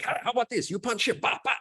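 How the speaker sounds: high, eager suggestion; excited mock plan; excited planning. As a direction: pitch it up like a giddy kid. Retold friends planning fight.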